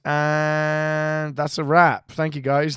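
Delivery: stretched-out slow wrap call; imitated director. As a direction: drag out the announcement like a director. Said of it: "and he goes".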